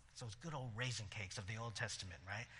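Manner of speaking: low soft amused aside; wry aside. Real, not acted. Pastor jokes to congregation about raisin cakes, low amused aside. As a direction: murmur it as a private joke.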